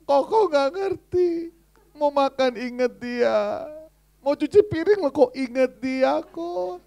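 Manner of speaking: high, slow, far hammed-up whine; whiny maid voice. Pastor voicing his lovesick maid whining that she can't stop thinking of him. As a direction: drag it out like a lovesick girl.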